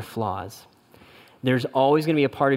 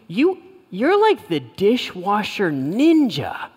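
delighted praise; 7 semitones higher, 4 dB louder, slower than usual. Retold or imitated. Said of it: "She goes".

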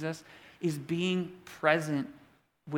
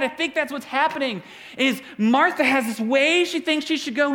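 ordinary talk

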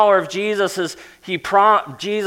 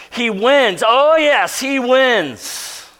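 higher, louder, triumphant "He wins"; emphatic triumph; 7 semitones higher, 5 dB louder, much slower than usual. Shown as a demonstration. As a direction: crow it like a victory cry. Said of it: "this emphatic oomph".

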